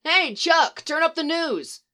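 shouting over the bar noise; shout across bar. A narrator's reading. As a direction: call to the bartender from afar. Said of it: "at a shout from across the bar".

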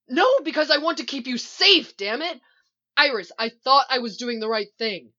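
loud, high, exasperated defence; heated protest. A narrator's reading. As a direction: argue back desperately at full voice. Shouted quarrel between Iris and Barry.

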